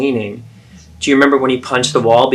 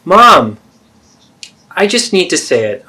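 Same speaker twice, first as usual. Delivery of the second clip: raised plea; 6 semitones higher, 5 dB louder than usual. Acted. Pleading to be heard.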